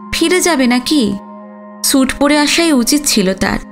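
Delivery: ordinary delivery, nothing marked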